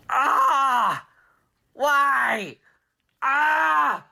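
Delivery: loud, high howl of frustration; villain's anguished wail. Acted. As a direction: wail in distant, thwarted agony. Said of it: "you could hear Planet X".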